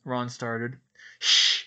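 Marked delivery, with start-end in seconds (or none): 1.2-1.7 sharp hissed shush. A narrator's reading. Harry hushes Ron on the stairs, hissed shush. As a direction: hush them fiercely. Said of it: "Harry hissed".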